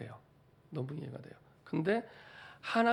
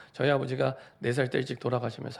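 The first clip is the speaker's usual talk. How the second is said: ordinary delivery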